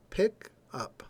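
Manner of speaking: "Pick" pitched high, then "up" falling away; stress on PICK. Shown as a demonstration. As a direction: lift "Pick", pause, drop "up". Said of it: "tone of voice goes up".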